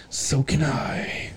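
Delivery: slow hushed whisper, no voice. Retold or imitated. Smug magician whispering back at a rival. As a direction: breathe it out slowly like a smug secret. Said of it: "David Blaine will be like".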